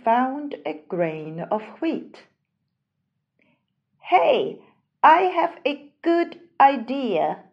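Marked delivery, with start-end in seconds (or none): 4.0-7.4 bright, loud little hen; excited hen with an idea. Acted. Hen character speaking.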